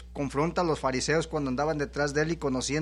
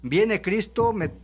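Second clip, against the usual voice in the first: fiery preaching; 3 semitones higher than usual. Acted. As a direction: preach it out with rising fervor. Preaching at full force.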